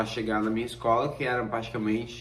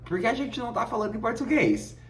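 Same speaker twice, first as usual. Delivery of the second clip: much higher, exasperated disbelief; incredulous; 11 semitones higher, faster than usual. Retold or imitated. Victor realises both speak Portuguese, incredulous. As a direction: ask it in comic disbelief. Incredulous question to Brazilian housemate.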